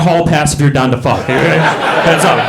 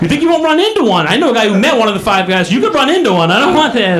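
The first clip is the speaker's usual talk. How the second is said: high-pitched, worked-up comic arguing; exasperated objection; 9 semitones higher than usual. Retold or imitated. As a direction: argue back in mock indignation. Acted quarrel with wife.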